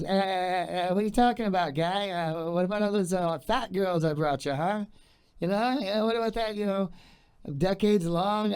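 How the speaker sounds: high, drawling put-on Norm voice with stuttering eh's; God answering in a hammed-up Norm voice, way up high; poor Norm Macdonald impression. Comic answering his prayer as God in a bad Norm impression. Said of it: "That was my poor impression of, uh, Norm".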